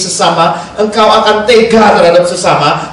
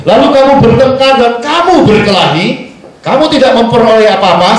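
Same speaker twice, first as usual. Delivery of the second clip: raised, forceful Bible reading with "kamu" hammered; scripture reading; 5 semitones higher than usual. Acted. Preacher reading James to the church, forceful and raised. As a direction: read it like a preacher driving the verse home. Reads Bible verse aloud.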